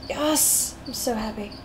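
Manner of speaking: ordinary delivery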